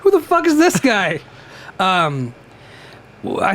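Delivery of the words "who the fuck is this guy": high, incredulous question; playing a baffled onlooker; mock baffled.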